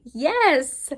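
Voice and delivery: high, loud burst on one word; acted emotional scream. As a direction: yell it as an outburst, short of a full scream. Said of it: "comes barreling with my scream".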